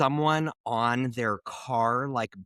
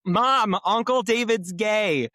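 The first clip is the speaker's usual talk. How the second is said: retold yell; 9 semitones higher, 5 dB louder, slower than usual. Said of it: "my niece just yelled".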